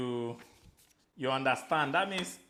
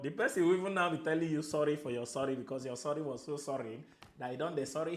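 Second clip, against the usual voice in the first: usual speaking voice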